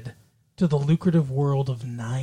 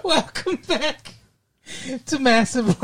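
mock showman host; 8 semitones higher than usual. Host greeting listeners in a put-on showman voice. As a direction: stretch it out like a cheesy game-show host.

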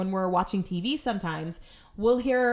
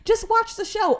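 high, loud exasperated order; shrill fed-up outburst; frustrated; 14 semitones higher, 7 dB louder than usual. Retold or imitated. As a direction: yell it, out of patience. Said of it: "he wonders why I get frustrated".

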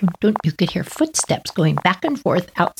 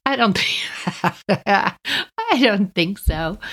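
laughing through the words, slowly; much slower than usual. Real, not acted. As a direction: break into a real laugh mid-sentence. Laughs at her own doubt.

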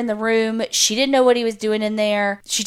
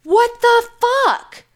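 retold outrage; 6 semitones higher than usual. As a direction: act out an appalled wife confronting her husband. Retold wife confronts husband over fire.

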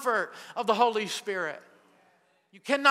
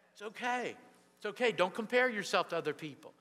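soft reassurance; 7 dB quieter than usual. Real, not acted. Gentle reassurance to the congregation.

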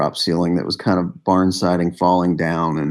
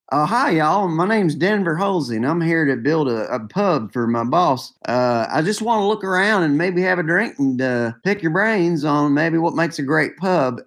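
imitating Denver; 9 semitones higher than usual. Retelling the builder meeting pub folk, high Southern voice. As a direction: do a cheerful Southern good old boy. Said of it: "And he's like".

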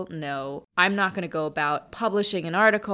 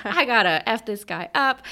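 louder, hammed-up angry Jersey friend; imitated furious threat; 5 semitones higher, 7 dB louder than usual. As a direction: play the friend ready to fight. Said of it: "my friend was super upset".

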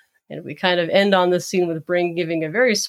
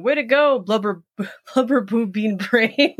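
a mock excited cheer for Brain; a hint of an excited Pinky; 2 semitones higher than usual. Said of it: "Pinky cheers".

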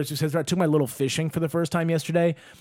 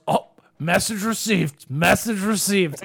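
put-on drunk dad conceding; 3 semitones higher, slower than usual. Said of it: "And he goes".

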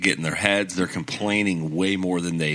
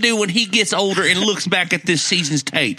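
mock scolding; 7 semitones higher than usual. Mock scolding Westbrook as fans.

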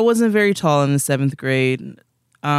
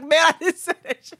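exasperated denial; 13 semitones higher than usual. Retold or imitated. Retold heated denial.

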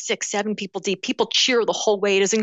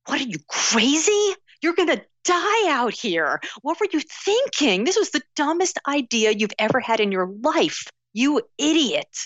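higher, mocking inner critic; a jeering voice in her head; inner doubting voice; 7 semitones higher than usual. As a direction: sneer at yourself like a nagging doubt. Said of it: "a voice inside my head that's going".

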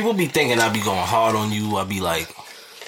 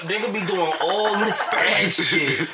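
laughing; 7 semitones higher, slower than usual. Real, not acted. Laughing through mockery.

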